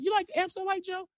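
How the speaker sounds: imitated agent's high-pitched checking question; coaxing agent. Agent checks with his silent star, high and coaxing. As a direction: pitch it way up, as if prompting him.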